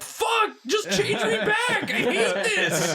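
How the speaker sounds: very high, slow panicked yell; panicked high shout. Acted. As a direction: yell like a cursed cartoon victim. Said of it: "He's just like".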